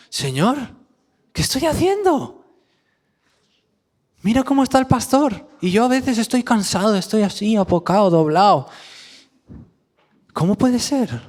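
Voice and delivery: high, pleading self-reproach to the Lord; high astonished plea; exasperated prayer. Retold or imitated. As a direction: cry out high at your own weakness. Preacher voices his own prayer.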